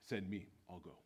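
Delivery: low, soft offer; hushed, simple resolve; calm volunteer. Acted. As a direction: step forward quietly and offer yourself. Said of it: "one gentleman steps forward and says".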